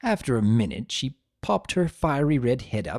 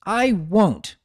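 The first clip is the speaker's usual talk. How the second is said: stubborn; 8 semitones higher, 4 dB louder than usual. Acted. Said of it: "stomping a hoof".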